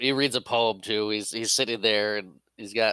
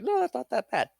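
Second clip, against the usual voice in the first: much higher, airy put-on voice; mock reassurance; 14 semitones higher than usual. Imagining someone's excuse, high mock breezy voice. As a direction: chirp it up like a cheery excuse.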